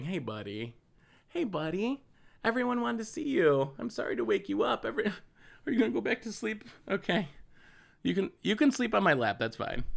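real tender pet talk; soft puppy talk. Real, not acted. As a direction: soothe the dog back to sleep. Apologizing to woken puppy.

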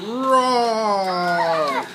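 long, drawn-out mock dinosaur roar; pretend T-Rex roar. Dad roars for the T-Rex during the kids' toy battle, drawn-out mock roar.

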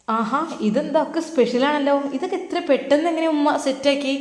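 high, delighted surprise at the food; pleasantly surprised. Acted. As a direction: sound amazed at the spread on the table. Son sees special breakfast.

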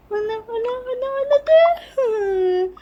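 put-on high, slow crying child voice; whimpering daughter. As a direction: sob out the answer to mother. Scolded daughter doll whimpering.

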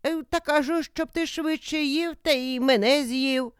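high-pitched character voice; old woman's voice. A narrator's reading. Old woman covers up her spell to the wolf, high-voiced.

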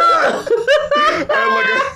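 loud real laugh breaking into the words; real laughing fit; laughing outburst. Real, not acted. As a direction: crack up loudly as you start to speak. Bursts out laughing mid-story.

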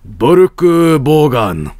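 slow, hammed-up reading of Boruku Bogan; mock exotic name. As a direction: announce Boruku Bogan like an anime title.

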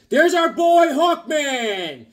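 high, loud, slow gleeful announcement; excited. Real, not acted. Reviewer cheering as Hawkman appears, high, loud and drawn out. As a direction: stretch it out like greeting a hero. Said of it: "this is one of my favorite comics".

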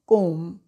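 कोम said kome, B silent; कोम pronounced kome; correct pronunciation. Shown as a demonstration. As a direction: model कोम with no B. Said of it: "B silent है".

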